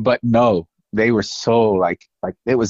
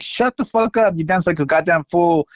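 a raised, higher mock yell of fed-up anger; snapping back at a heckler, voice pitched up; angry retort; 6 semitones higher than usual. Marta telling off a heckler in the crowd, raised mock yell. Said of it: "And she's like".

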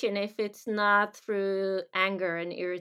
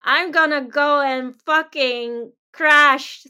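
loud, defiant snarl, slowing on the threat; defiant ego voice; 4 semitones higher, 11 dB louder, slower than usual. Pretend. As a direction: throw the challenge back hard. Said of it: "feels like you have something to prove".

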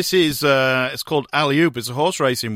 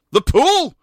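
high, louder puzzled question; surprised; 12 semitones higher, 6 dB louder than usual. A narrator's reading. Manager puzzled by the maid's answer, high and surprised. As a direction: catch on the word in disbelief.

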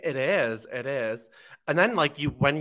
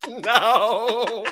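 ordinary talk

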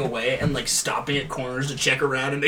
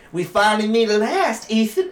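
put-on southern accent; 6 semitones higher, 4 dB louder than usual. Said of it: "she's got, like, a southern accent".

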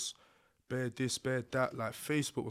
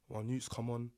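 ordinary delivery

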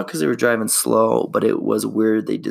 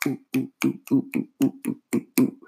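mock fame hoots; 3 semitones higher, 3 dB quieter than usual. Celebrity hype noise.

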